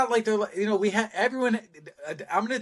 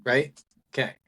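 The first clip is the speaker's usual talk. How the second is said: normal talking voice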